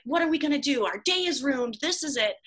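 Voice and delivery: high-pitched, overdramatic despair; frustrated yelling. Retold or imitated. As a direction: rant as if the whole day is lost. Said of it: "I was just yelling".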